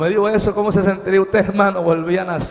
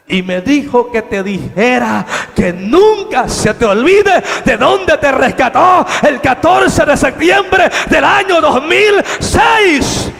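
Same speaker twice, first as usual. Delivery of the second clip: building proclamation; 7 semitones higher, 9 dB louder, slower than usual. Retold or imitated. Angel's message climaxes.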